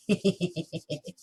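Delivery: playful villainous tittering; mock evil giggle. Teacher teasing that harder patterns await, mock evil giggle. Playing evil [name].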